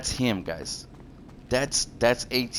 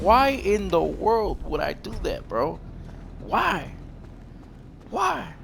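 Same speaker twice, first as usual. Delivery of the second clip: why, rising higher each time; incredulous, rising plea; exasperated; 8 semitones higher, 4 dB quieter, slower than usual. Real, not acted. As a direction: pitch climbing to a high disbelieving cry. Exasperated questions at rival.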